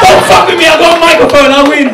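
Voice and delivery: mock defiant shout. Real, not acted. Defying host with the microphone.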